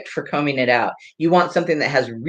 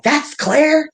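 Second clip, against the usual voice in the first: astonished question; 14 semitones higher, 3 dB louder than usual. Retold or imitated. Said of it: "And they're like".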